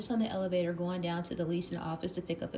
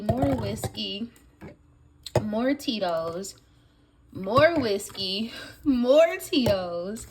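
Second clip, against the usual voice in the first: amazed counting; 4 dB louder, slower than usual. Real, not acted. Listing bottles slowly, amazed.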